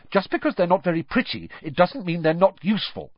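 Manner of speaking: quick, high-pitched retort; brisk indignant correction; snapping. A narrator's reading. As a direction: snap back in their defence. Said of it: "Hermione snapped".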